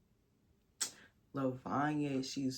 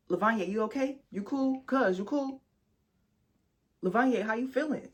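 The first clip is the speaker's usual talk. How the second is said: higher, a touch nasal concerned friends; mock concerned friends; 7 semitones higher than usual. Voicing her friends' worried texts, higher mock concern. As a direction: lightly voice the worried texters.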